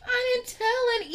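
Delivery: put-on very high, innocent little old lady voice; mock grandma denial; imitating grandma. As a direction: play the denying grandma sweetly. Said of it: "she's like".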